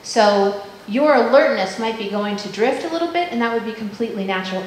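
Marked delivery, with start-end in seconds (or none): none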